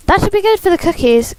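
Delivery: light upbeat side character. Acted. Voicing Shane in game.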